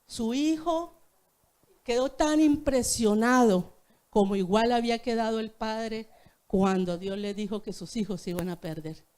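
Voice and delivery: normal talking voice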